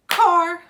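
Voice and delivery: Car as one loud, short, high beat; clapped-out syllable. Shown as a demonstration. Teacher trying out a word with claps, Car in one loud beat. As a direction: punch out Car in a single crisp burst. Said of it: "Let's do it again".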